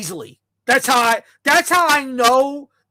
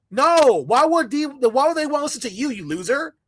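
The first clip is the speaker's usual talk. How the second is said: ordinary talk, nothing marked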